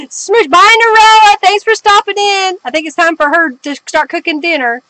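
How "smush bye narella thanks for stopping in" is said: loud, high, cheery farewell; called-out goodbye. Real, not acted. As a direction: call out a goodbye to someone leaving. Calls goodbye to departing viewer.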